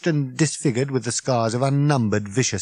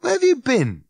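higher, insistent Ford; demanding; 4 dB louder than usual. Acted. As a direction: demand an answer. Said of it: "he demanded".

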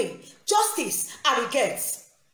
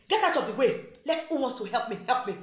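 ordinary talk

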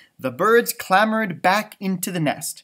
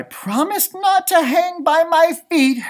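sighed line; 10 semitones higher, 6 dB louder than usual. Acted. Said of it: "Stellaluna sighed".

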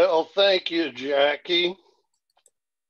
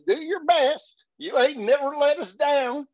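boss voice, higher; 11 semitones higher than usual. Acted. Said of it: "Old boss said".

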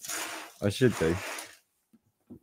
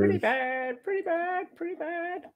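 high squeaky parrot squawk; 5 dB quieter than usual. Pretend. Imitating parrot after talk of parrots.

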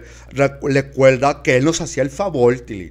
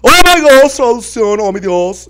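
despairing wail; 12 semitones higher, 9 dB louder, slower than usual. Acted. Aunt wails in despair.

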